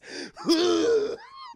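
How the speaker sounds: high mock retching noise; imitated vomiting. Streamer mimics a gnome vomiting rainbows, imitated retch. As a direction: mimic a long comic puke. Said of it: "he just begins vomiting rainbows".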